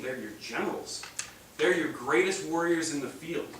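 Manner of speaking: usual speaking voice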